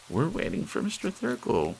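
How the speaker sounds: put-on high prim old lady hushing the room; fussy old lady. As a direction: scold like a prim elderly lady. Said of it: "another lady shaking her fingers and saying".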